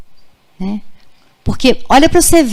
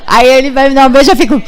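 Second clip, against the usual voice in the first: laughing through the words, higher; real giggle breaking into the line; mother laughing as she tells of checking her son; 4 semitones higher, 4 dB louder, faster than usual. Real, not acted. Laughs recalling son's late kiss.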